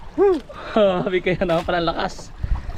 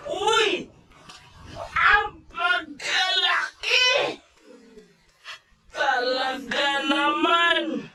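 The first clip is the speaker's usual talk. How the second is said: slow, stretched, higher shout; drawn-out shout; 11 semitones higher, 4 dB quieter, much slower than usual. Real, not acted. Angler hollers across the boat at the huge fish, drawn-out shout. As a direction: belt it out, dragging every word.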